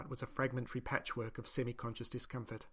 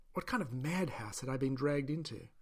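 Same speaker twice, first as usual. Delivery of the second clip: usual speaking voice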